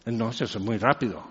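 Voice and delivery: low and quick, hushed rattle; mock fast speaker, mumbled low; fast, quiet mock rush. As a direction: rattle it off softly like fast talkers. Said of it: "Y están hablando así".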